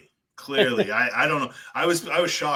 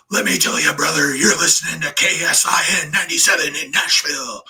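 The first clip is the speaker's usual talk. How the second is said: louder, gruff wrestling-promo growl; imitating Hulk Hogan cutting a radio promo; 6 semitones higher, 8 dB louder than usual. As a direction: snap into a pro wrestler hyping the station. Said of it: "he'd just snap into it".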